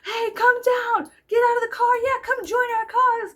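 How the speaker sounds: high, loud mock activist call; imitating shouting protesters. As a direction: shout an invitation over the noise. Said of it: "they were like".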